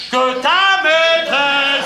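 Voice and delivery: very slow, drawn-out declamatory cry; shouting. Acted. Husband broadcasting his brother's affair, shouting slowly. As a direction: proclaim it, stretching every syllable. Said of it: "Mais pourquoi tu cries?".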